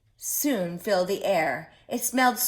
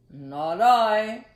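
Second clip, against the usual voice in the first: a louder, different dog voice; 7 dB louder than usual. Acted. Dog turning down the hen, loud and gruff. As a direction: bark it out like a big dog.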